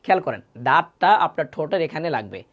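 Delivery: ordinary talk, nothing marked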